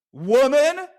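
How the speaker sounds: high, loud spat-out "woman." with a rising end; furious shout. Retold or imitated. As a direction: hurl "woman." at her in rage. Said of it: "Oh, that just came out".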